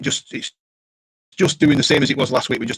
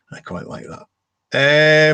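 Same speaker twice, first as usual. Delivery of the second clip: drawn out; 2 semitones higher, 4 dB louder, much slower than usual. Shown as a demonstration. Repeated slowly, drawn out.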